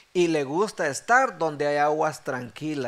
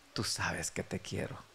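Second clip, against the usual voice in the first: very soft, low, subdued; hushed, understated sorrow; retold tearful reply; 9 semitones lower, 13 dB quieter than usual. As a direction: confess it quietly, imitating a man brought to tears. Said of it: "cuando comienza a llorar".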